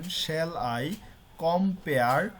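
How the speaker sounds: quick light "Shall" and "com", long forceful "I" and "pare"; iambic stress demo. Shown as a demonstration. Teacher asks students to notice the pronunciation, iambic stress demo. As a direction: punch and stretch every second syllable, "I" and "pare". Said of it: "জোর করে উচ্চারণ করতে হচ্ছে".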